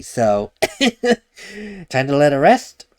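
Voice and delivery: usual speaking voice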